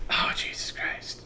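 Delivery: slow whispered groan of a curse; imitating a sleepy whisper; sleep-talk whisper. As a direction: sigh it out half asleep. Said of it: "it was just like".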